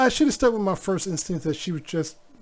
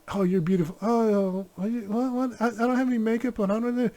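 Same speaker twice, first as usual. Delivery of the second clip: imitating wife; 6 semitones higher than usual. Imitating wife deflecting compliment.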